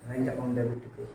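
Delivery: soft, low recitation of the entry; said softly. Shown as a demonstration. Accounts teacher shows the class how softly to say it, quietly. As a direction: murmur it under your breath. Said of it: "धीरे से बोल".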